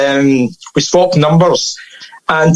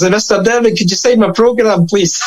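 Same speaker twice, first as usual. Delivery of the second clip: higher-pitched eager fan; wee guy; 5 semitones higher than usual. Retold or imitated. Wee guy asking a supposed club boss for an autograph, higher and polite. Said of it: "a wee guy ran up to me".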